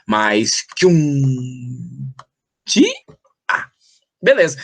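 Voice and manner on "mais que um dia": very slow, stretched-out "mais que um di-a"; syllable by syllable. Shown as a demonstration. Teacher cuts the verse into syllables, long drawn monotone. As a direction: draw out "mais que um", then split "di-a". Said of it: "pra ver como é que a gente fala isso".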